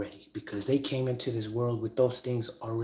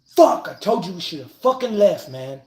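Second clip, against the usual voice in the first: louder, higher exasperated outburst; frustrated I-told-you-so; 5 semitones higher, 7 dB louder than usual. Retold or imitated. Stuck on the curb, he yells I told you at his friends. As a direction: vent at the friends who wouldn't listen. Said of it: "until you're all sitting on the curb".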